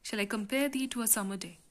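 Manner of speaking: the verse read briskly in one go; quick reading. Shown as a demonstration. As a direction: run the line through without pausing. Repeats the line in one go.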